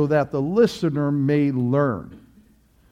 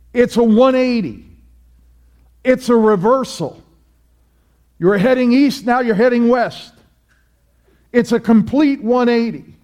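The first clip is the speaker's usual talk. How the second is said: rising, louder sermon voice; fiery preaching; 5 semitones higher, 4 dB louder than usual. Acted. Preacher exhorting congregation to repent, fiery preaching. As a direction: preach it hard to the pews.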